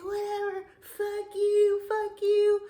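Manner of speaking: put-on high, loud hillbilly drunk yelling; belligerent drunk. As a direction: bark it like a sloppy bar drunk cursing. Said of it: "He's a drunk".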